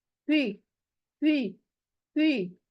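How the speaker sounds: heavy सी with rounded lips. Shown as a demonstration. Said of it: "कैसा मुंह बनता है".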